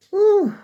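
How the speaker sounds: loud, high breathless whew; real out-of-breath whew; big exhale. Real, not acted. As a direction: blow out hard, winded. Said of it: "I'm so out of breath".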